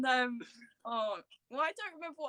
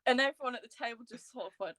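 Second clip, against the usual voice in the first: ordinary talk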